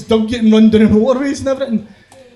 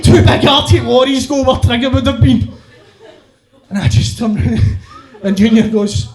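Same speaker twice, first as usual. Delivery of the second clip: ordinary talk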